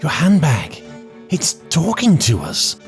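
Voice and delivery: startled. A narrator's reading. Jo startled by handbag.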